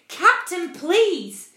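loud, high, insistent protest; sharp. A narrator's reading. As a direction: urge the captain sharply to stop. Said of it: "the first officer said sharply".

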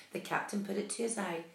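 ordinary delivery

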